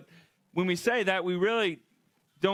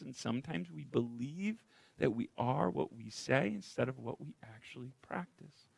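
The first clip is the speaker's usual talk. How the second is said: ordinary talk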